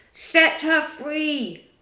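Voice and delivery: slow, loud, commanding pirate captain; imitated captain's order. A narrator's reading. Peter, posing as Hook, orders pirates, slow and loud. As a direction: draw out a stern order from the captain.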